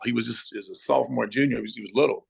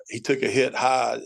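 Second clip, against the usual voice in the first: ordinary talk, nothing marked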